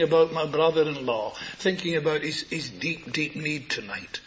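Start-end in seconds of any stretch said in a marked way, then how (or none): none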